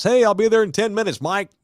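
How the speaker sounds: brisk acted phone call; dad on phone. Voices dad phoning the house.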